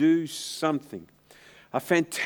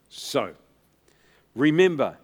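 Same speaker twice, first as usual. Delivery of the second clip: ordinary talk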